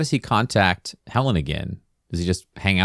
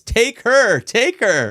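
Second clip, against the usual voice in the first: hammed-up panicked yell, higher; yelling to hand her over, hammed up; mock yell; 11 semitones higher, much slower than usual. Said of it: "He yells".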